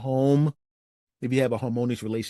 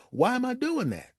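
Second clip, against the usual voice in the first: "Why am I doing that?" asked much higher, baffled; puzzled to himself, pitched high; 10 semitones higher than usual. Retold or imitated. Catching himself in the window, high and baffled. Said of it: "and I went".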